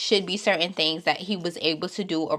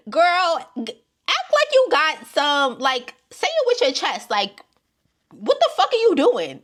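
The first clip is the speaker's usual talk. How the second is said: high, loud exasperated yelling; exasperated rant; 8 semitones higher, 5 dB louder than usual. Real, not acted. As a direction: shout in disbelief at her. Ranting at contestant, high and loud.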